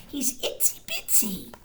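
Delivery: tiny whispered aside. Real, not acted. Reading to children, a whisper. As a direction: whisper how small he is.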